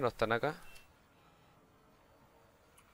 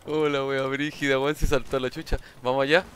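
hyped shouting; 5 dB louder than usual. Real, not acted. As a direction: shout it out, pumped up. Hyped after the big play.

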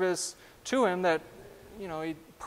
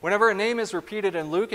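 usual speaking voice

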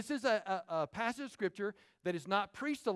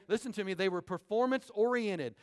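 ordinary talk

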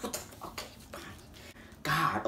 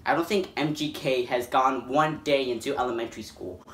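normal talking voice

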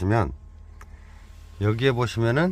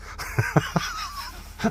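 real laugh; 6 dB quieter than usual. Real, not acted. Laughs at own joke.

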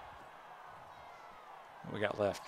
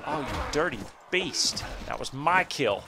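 indignant outburst; 5 semitones higher, 5 dB louder, slower than usual. Real, not acted. Enemy steals his kill.

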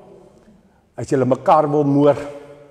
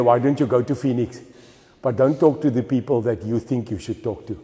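usual speaking voice

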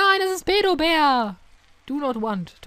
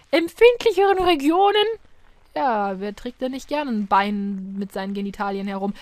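usual speaking voice

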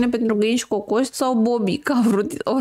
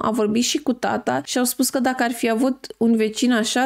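usual speaking voice

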